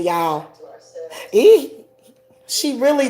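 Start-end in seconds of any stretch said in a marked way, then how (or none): 1.3-1.7 fake laugh. Shown as a demonstration. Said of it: "She was fake laughing".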